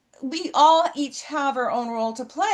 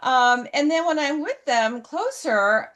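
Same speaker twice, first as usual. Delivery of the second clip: ordinary talk, nothing marked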